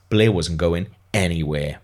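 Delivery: plain reading voice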